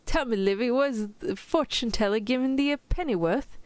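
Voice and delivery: mock-British storybook reading; attempted British accent. Shown as a demonstration. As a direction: read it in your best fake British. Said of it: "in your best British accent".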